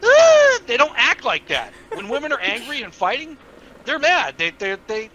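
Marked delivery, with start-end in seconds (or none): 0.0-0.6 very high, loud scream; mock dramatic shriek. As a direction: shriek like an overdramatic imitation. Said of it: "all dramatic and stupid".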